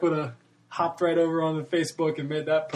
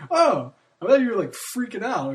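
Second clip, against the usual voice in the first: put-on girlfriend relieved; 8 semitones higher, 5 dB louder than usual.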